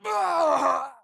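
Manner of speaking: a sharp high yelp; pained cry. Acted. Hero hit by attacking spiders, sharp cry.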